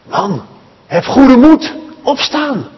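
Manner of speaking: the crowd cheering the beggar on; eager encouragement. Acted. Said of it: "Nu zeggen ze tegen Bartimaeus". (hoog en opgewonden aanmoedigen)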